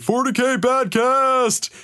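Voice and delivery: high, loud shout across the hall; shouted call. Retold or imitated. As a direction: yell it out like a rowdy hooligan. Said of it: "he just shouted".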